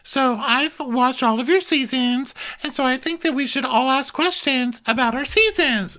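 high mock Brandy voice; Brandy impression. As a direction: squeak it out like a flustered Brandy.

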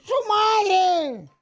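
high, drawn-out mock cry; called-back retort. Voice in the dark answering the soldier, called retort. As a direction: fling it back high and long.

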